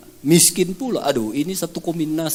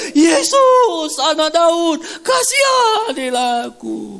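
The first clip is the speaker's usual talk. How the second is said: high, loud pleading shout, slower at the end; crying out again; 13 semitones higher, 4 dB louder, much slower than usual. Acted. Blind Bartimaeus shouting again to Jesus, pleading and loud. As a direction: shout it louder, begging for mercy. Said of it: "Lalu teriak lagi dia".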